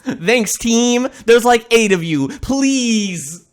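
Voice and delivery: high mock-gracious thanks; exasperated sarcastic plea. Real, not acted.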